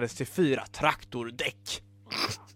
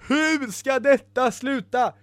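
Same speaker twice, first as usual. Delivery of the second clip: high, slow, despairing wail; drawn-out tearful whine; close to tears; 8 semitones higher, slower than usual. Acted. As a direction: lament it as if about to cry. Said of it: "sa Ibelina gråtfärdigt".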